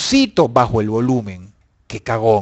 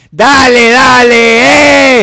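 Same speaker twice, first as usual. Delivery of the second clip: high, loud drawn-out dale; shouted dale; 8 semitones higher, 11 dB louder, much slower than usual. Real, not acted. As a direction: stretch dale into a long yell. Grita dale a los espectadores. (gritando dale alargado, agudo y fuerte)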